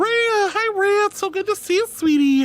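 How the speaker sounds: high, sugary hello; sweet high greeting. Real, not acted.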